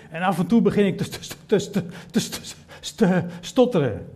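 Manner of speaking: mock stutter, stuck on te; a faltering Moses stammering. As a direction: act out the stammer.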